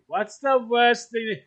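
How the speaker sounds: high-pitched, slow and loud again; teasing. Real, not acted. Repeating the taunt to his partner, high and slow. Teasing about dog poop.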